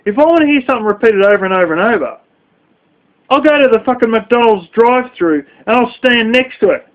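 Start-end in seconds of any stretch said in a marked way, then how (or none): none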